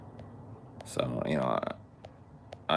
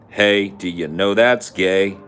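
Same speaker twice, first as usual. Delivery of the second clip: slow, loud, higher taunt; mock taunt; 4 semitones higher, 6 dB louder, slower than usual. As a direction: drag it out like a playground jeer.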